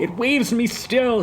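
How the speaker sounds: high, urgent outcry; crying out. Acted. Hamlet struggling free from his friends, crying out. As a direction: call out with determination. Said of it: "he cried".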